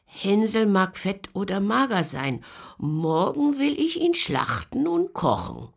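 put-on loud, high shout from the old witch; impatient witch yelling for Gretel; witch calling out. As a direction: bark across the house to Gretel. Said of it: "rief sie".

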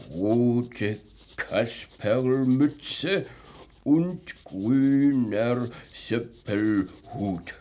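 drawn out, word by word; slow dictation. Acted. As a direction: repeat it slowly while writing it down. Policeman repeats it while writing.

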